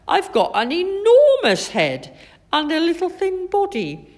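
high, loud, tickled delivery; amused exclamation. A narrator's reading. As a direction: exclaim in mirth, without really laughing. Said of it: "laughed Wilf".

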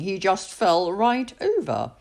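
usual speaking voice

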